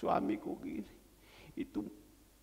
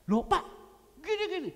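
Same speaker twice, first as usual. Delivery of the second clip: startled protest; 8 semitones higher, 5 dB louder, faster than usual. Retold or imitated. Wife startled by the suit request.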